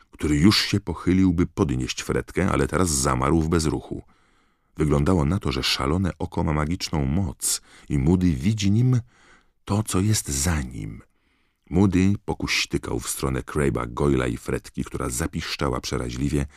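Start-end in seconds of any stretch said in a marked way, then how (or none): none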